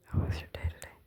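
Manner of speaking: very soft unvoiced whisper; whisper test. Shown as a demonstration. Testing whether viewers can hear her whisper, barely audible. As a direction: whisper it almost too quietly to hear. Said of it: "I'm gonna say it".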